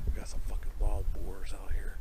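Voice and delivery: hushed whisper in the dark. Real, not acted. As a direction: whisper it so nothing hears.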